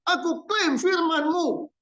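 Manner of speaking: high, drawn-out mock faith-claim prayer. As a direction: parody someone claiming a miracle.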